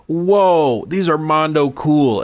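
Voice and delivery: high, wide-eyed awe, drawn-out whoa; Gohan impression. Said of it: "Gohan goes".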